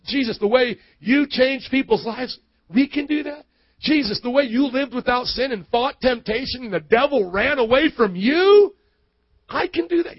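high, rising incredulous questions; amazed disbelief. Acted. Disciples asking Jesus if they can do that, acted amazement. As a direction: build in pitch to an astonished question.

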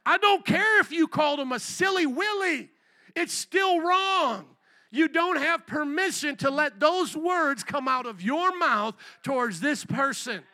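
loud, high, drawn-out reprimand; stern rebuke. Acted. The Lord correcting the angry pastor, stern rebuke. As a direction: lay down the law word by word.

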